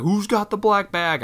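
high, drawn-out mock agent call. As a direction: sing it out across the checkpoint, slowly. Voicing agent calling out.

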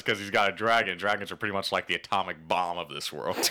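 ordinary talk

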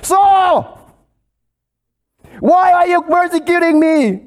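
a thunderous divine call from the sky; voice from heaven. Acted. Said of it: "heard a voice saying to him".

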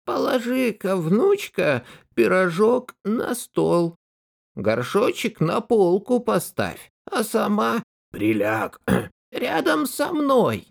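high sugary fake grandma; wolf in disguise coaxing sweetly; wolf faking granny. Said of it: "волк ... говорит".

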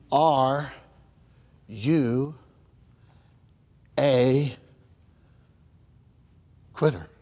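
low, soft, each word spaced; slow pointed question. Acted. Preacher asking the congregation, spaced and quiet. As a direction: pause after every word.

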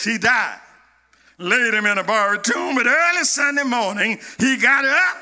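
usual speaking voice

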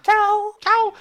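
very high cute tchau; squeaky bye. Pretend. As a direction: chirp it in a tiny voice.

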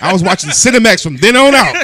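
high laughing outburst. Real, not acted. Friend jokes about the movie, laughing loudly.

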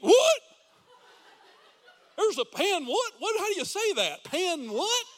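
loud, high, hammed-up confused voice; mock confusion. Pastor mocking critics' view of believers, hammed-up confused. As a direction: play dumb, as if you never heard the word.

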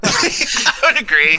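high, drawn-out words through laughter; laughing. Real, not acted. Co-host agreeing the yelling was uncalled for, laughing. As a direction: agree while cracking up. Cracking up at co-host's understatement.

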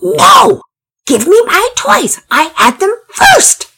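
very high, loud tantrum shriek; shrieking child. A narrator's reading. Pablo demands his toys back from Pearson, shrieking. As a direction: shriek each word out slowly like a furious little boy. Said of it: "Pablo was shrieking".

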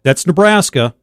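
ordinary talk

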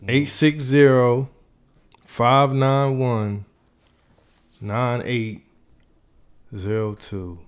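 reading digits out slowly; slow number read. Real, not acted. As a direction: spell out the number, slow and clear. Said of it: "I'm gonna say it again".

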